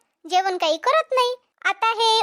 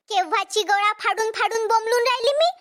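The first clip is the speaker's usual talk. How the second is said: normal talking voice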